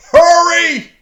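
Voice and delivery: high, loud "Hurry!"; urgent shout. Acted. Preacher rereading the psalm's plea, shouting.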